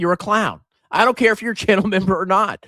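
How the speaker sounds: ordinary talk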